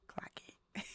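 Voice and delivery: breathy whispered quip; sassy whisper. Acted. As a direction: whisper it like a secret dare. Playful aside about her year.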